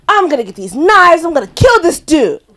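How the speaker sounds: high, loud hammed-up threat; mock furious threat. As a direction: threaten at full voice, playing it for laughs. Re-enacts threatening the dude.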